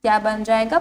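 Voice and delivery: ordinary talk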